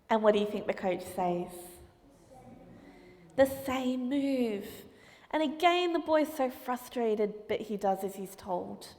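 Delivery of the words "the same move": drawn out, very slow and calm; slow deadpan. Acted. Coach slowly tells the boy to repeat the move. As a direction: let each word land slowly.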